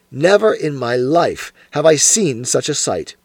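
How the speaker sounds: usual speaking voice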